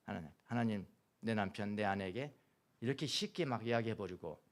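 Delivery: quiet confession prayer. Shown as a demonstration. Pastor models the congregation's repentance, hushed penitent prayer. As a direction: confess humbly, barely above a murmur.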